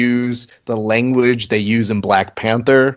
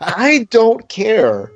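higher, slow put-on voice, brushing it off; mock dismissive; 10 semitones higher, much slower than usual. Host waving off a listener's complaint, slow and dismissive. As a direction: drag it out like you couldn't care less.

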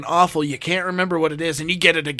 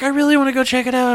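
much higher, louder, fast and eager; imitating eager wife; 10 semitones higher, 6 dB louder, faster than usual. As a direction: sell it like she can't wait. Wife's eager request quoted.

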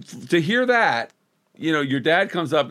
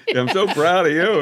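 laughing mock dad; 3 semitones higher, 4 dB louder than usual. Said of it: "mocking dad's praise".

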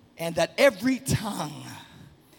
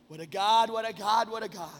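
ordinary talk, nothing marked

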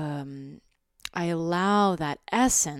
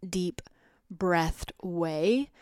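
ordinary talk

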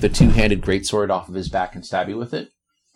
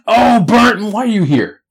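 much higher and louder, booming surprise; loud high orc greeting; 13 semitones higher, 12 dB louder than usual. Acted. Orc hailing the barbarian, loud and high. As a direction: call out the name in a big high voice.

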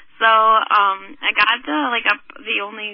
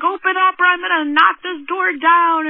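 raised, high imitation of a man threatening; mock angry yell; retold yelling; 8 semitones higher, 5 dB louder than usual. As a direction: demand it loudly through a closed door. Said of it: "yelling at me and screaming through the window".

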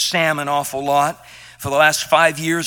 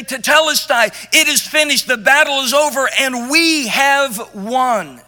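louder and higher, triumphant herald; victorious announcement; 6 semitones higher, 5 dB louder than usual. Retold or imitated. Runner to his hometown, announcing triumph. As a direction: cry out the victory news. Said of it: "send a runner back".

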